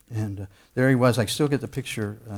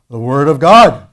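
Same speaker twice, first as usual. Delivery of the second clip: imitating grandpa's over-excited proclamation, very loud; sudden big, thunderous outburst in grandpa's voice; booming grandpa; 17 dB louder than usual. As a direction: boom it out like a fervent grandpa. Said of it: "calm down, it's not Disney World".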